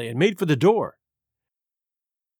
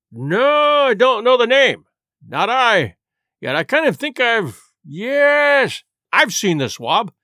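higher, drawn-out no and yes; musing recollection; 5 semitones higher, 4 dB louder, slower than usual. Acted. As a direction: act out a memory slowly dawning. Silver pretends to search his memory.

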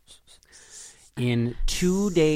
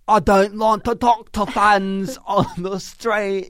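snooty pop star; 9 semitones higher, 8 dB louder, slower than usual. Retold or imitated. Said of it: "She'd be like".

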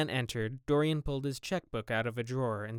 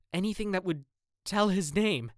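anxious urging; 8 semitones higher, 4 dB louder than usual. Acted. Dorian anxious, presses keeper.

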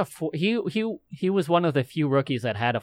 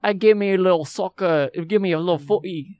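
higher, louder put-on English footy voice; hammed-up British accent; mock British accent; 8 semitones higher, 5 dB louder than usual. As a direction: play a cheeky English football fan. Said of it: "Where do you guys think you're from?".

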